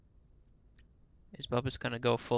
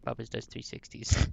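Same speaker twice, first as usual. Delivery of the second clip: ordinary delivery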